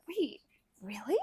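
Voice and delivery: breathless whispered disbelief; hushed stunned question; shocked whisper. Real, not acted. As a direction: gasp it out in a whisper.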